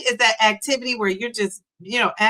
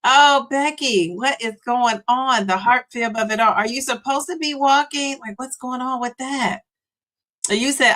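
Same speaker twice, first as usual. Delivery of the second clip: normal talking voice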